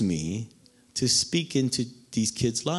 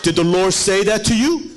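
Pastor Sam; 5 semitones higher, 6 dB louder than usual. Retold or imitated. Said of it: "And he would be like".

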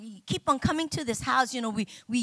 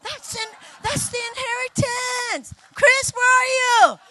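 high, loud rallying cry; exultant shouting; 11 semitones higher, 8 dB louder than usual. Real, not acted. Preacher rallies engaged couples to get ready, exultant shout. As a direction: shout it out high to the whole room.

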